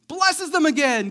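ordinary talk, nothing marked

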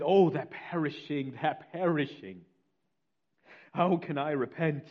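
preacher voicing a man struck by guilt; crying out. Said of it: "he begins to cry out".

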